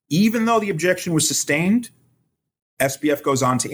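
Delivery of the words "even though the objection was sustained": ordinary talk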